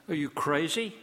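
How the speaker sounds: ordinary talk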